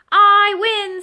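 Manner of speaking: high, loud, stretched victory shout; triumphant cry. A narrator's reading. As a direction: crow over the win. Thistle wins the contest.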